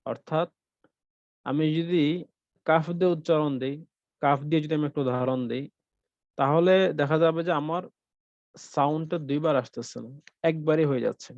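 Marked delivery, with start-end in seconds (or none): none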